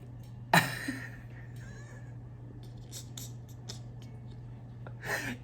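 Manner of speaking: long high breathless laughter, fading; long silent laugh. Real, not acted. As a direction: laugh helplessly until the breath runs out. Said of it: "okay, I'm not laughing".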